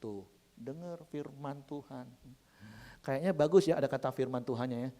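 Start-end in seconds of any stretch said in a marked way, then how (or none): 0.0-2.1 slow, softened pointed aside; hushed aside. Retold or imitated. Spouse nudging partner during the sermon, hushed and slow.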